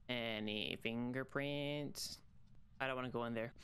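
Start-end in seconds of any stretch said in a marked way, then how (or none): 0.1-2.2 very slow, stretched fingerprints; drawn out. Shown as a demonstration. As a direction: drag out fingerprints slowly. Repeats slower, drawn out.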